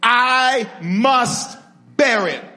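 "I must bear it" slow, high and loud; emphatic call to repeat. Shown as a demonstration. Preacher leading the church to repeat, slow emphatic declaration. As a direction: stretch "I" and "must" as separate beats. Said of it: "Say it with me".

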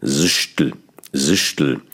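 "Sistl" with a hard hissed sh and clipped l; hissing consonants. Shown as a demonstration. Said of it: "das Problem sind die Konsonanten".

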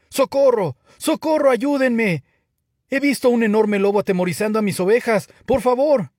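high, urgent pleading yell; shouting for help. A narrator's reading. Shepherd boy repeating his wolf prank, shouting for help. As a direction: cry out at full volume. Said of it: "comenzó a gritar".

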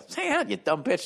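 high, mocking, rising insult; hammed-up obnoxious invite; rude. Shown as a demonstration. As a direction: toss it off like a jerk. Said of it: "Don't say it like that".

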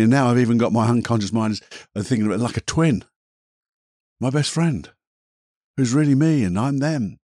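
ordinary talk, nothing marked

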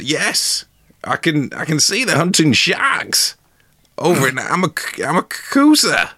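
high, rising mock wonder; hammed-up amazed spectator; feigned excitement. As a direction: build to a high-pitched marvel. Hero feigns seeing marvels.

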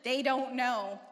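slow, pointed you-owe-me; teasing deal. Retold or imitated. Daughter telling dad he owes her, slow and pointed. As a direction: stretch it out, making him owe you. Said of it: "I was like".

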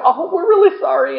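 high, put-on sorry voice of the people; mock apology. People apologising to God, acted quote.

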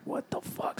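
a hushed, breathless whisper; whispered curse. Retold or imitated. Whispering to friends after hearing a noise on the stairs. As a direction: breathe it out unvoiced in fear.

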